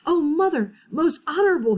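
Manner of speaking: high, loud and out of breath; panting. Acted. Chang panting to his mother, breathless and high. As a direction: gasp it out after running. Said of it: "he panted".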